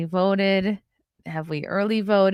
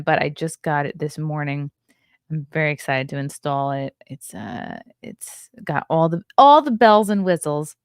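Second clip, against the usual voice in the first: ordinary talk, nothing marked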